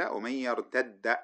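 يرتد said with one doubled d and a vowel on it, slow; merged with a vowel. Shown as a demonstration. Teacher showing the merged reading of the verse. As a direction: merge the two d's in يرتد and voice the end. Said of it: "هكذا بالتحريك".

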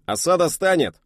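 very high, loud report; rumbling shout. Acted. Radio operator shouting the report over the noise. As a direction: boom the report out. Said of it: "рокотнул радист".

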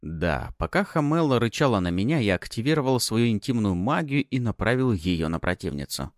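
ordinary delivery

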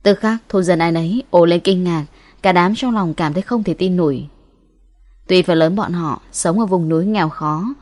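ordinary delivery, nothing marked

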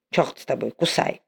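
low, soft, giving in; grudgingly softened. Acted. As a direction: give in with a grumble. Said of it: "неожиданно смягчается".

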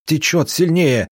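high-pitched cry of alarm; alarmed yell. A narrator's reading. Passenger seeing a new crack in the boat, alarmed yell. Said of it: "завопил Роман Романыч".

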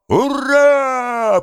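long, high victory cry; exultant drawn-out hurrah; triumphant cheer. A narrator's reading. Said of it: "победно закричали".

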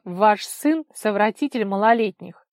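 loud, declaring accusation; loud denunciation. A narrator's reading. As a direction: announce it loudly for all to hear. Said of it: "и громко сказала". (громко, во всеуслышание)

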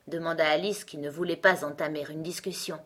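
plain reading voice

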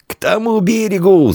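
high-pitched shout across water; calling out. A narrator's reading. Boy in the river to his friend, shouting. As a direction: call out high to a distant friend. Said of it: "закричал Диан".

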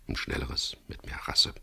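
softly whispered wish; dreamy whisper. A narrator's reading. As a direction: let the daydream slip out in a whisper.

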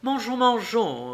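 high, eager invitation; eager urging. A narrator's reading. Wife calling husband to the meal, eager. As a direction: urge them brightly to start eating.

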